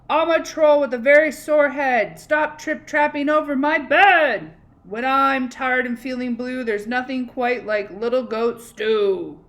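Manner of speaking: grumpy troll. Acted. Troll roaring at little goat to get off his bridge. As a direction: bellow it like a cranky troll.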